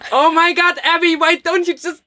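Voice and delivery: high, laughing mock exasperation at Abby; exasperated mock quote. Imitating her partner's exasperation at Abby, laughing. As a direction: play the fed-up partner, half laughing.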